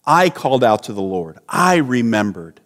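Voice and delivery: "I" leaned on at the head of each sentence; low voice, accent landing on "I"; self-regarding weight on "I"; stress on I. Acted. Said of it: "the focus seems to be on Jonah".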